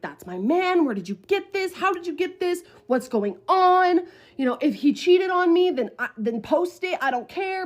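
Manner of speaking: high, agitated rush of questions; upset, freaking out. Retold or imitated. Storyteller demanding answers from the blackmailers, upset. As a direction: replay frantic demands, pitch climbing. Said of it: "because I was upset".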